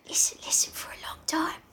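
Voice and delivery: soft, voiceless Essex whisper; whispered Essex accent. Acted. Retrying Piggy's line in the story, whispered Essex accent. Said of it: "I can't whisper in an Essex accent".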